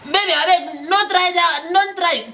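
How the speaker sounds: high, alarmed begging; alarmed pleading. Acted. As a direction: panic at the thought of losing her. Begging girlfriend not to date.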